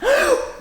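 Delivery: sharp high gasp of breath; demo gasp. Shown as a demonstration. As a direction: gulp in air to show how. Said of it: "Hold your breath like this".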